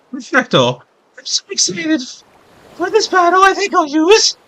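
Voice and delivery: very high, hammed-up Italian accent; excited, badly faked Italian accent; attempted Italian accent. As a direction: squeal it in a mock Italian accent. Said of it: "I can kind of do that, that accent".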